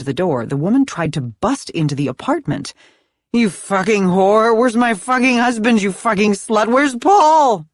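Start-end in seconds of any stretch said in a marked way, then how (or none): 3.3-7.7 loud, slurred, furious screaming; drunken scream. Acted. Drunk wife at the door screaming for her husband, furious yell. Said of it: "she'd screamed".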